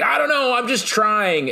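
high-pitched imitation of a helpless excuse; hammed-up defensive whine; whining protest. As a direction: put on a high, pleading mock voice. Voicing a character's helpless protest.

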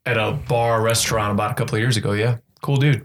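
normal talking voice

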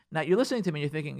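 normal talking voice

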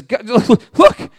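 laughing through the promise; a nervous happy laugh while vowing to fix it; laughing as he repents. Acted. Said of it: "He says".

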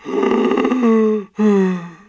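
loud, drawn-out donkey sigh; hammed-up mournful heave; long sad sigh. A narrator's reading. Eeyore finding his tail gone, loud sad sigh. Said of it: "with a long, sad sigh".

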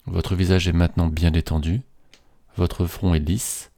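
ordinary talk

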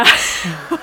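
embarrassed laugh. Real, not acted. Embarrassing subway story.

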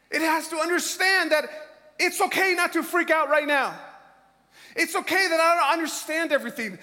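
building preacher's exhortation; fervent preaching. Acted. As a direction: push it out to the whole church. Preaching at full force.

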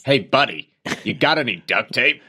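hammed-up American buddy; teasing American impression; mock American accent. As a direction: put on a chummy American accent. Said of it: "Sorry to all Americans".

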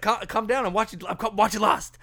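loud and high, hurried and stumbling; flustered outburst. Retold or imitated. Telling Ash he is just watching Lost, loud and flustered. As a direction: re-enact being too wrapped up to calm down.

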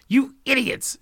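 very high, loud yell; angry shout. A narrator's reading. The Smiths shout back at the Joneses, angrily. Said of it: "shouted the Smiths".

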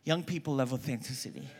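ordinary talk

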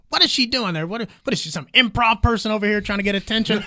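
high, incredulous mock rant; Bill Burr. Bill Burr mocking the hula-hooping comic, high. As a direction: scoff at the hula-hooper. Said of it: "Bill Burr goes".

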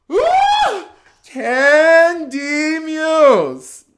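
a very high loud woo, then the name stretched out; excited cheer. Real, not acted. Telling friends about the show, cheering the queen like a fan.

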